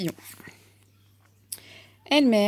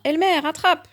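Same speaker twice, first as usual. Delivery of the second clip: high, loud shout down the cliff; 8 semitones higher, 6 dB louder than usual. A narrator's reading. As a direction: throw the call down to him. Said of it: "en criant".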